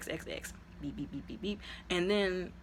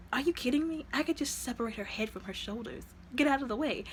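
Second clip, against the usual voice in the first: higher, exasperated mock threat; mock angry threat; 6 semitones higher than usual. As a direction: scoff then bark it impatiently. Brother threatens violence.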